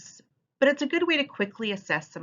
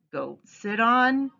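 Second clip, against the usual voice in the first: slow prompting tone; 5 semitones higher, 4 dB louder, much slower than usual. Shown as a demonstration. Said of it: "let the students come up with their own ideas".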